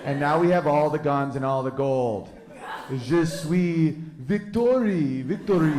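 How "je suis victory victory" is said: put-on slow, drawn-out French-accented boast; taunting pretend French; mock French accent. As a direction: savor it in a fake French accent.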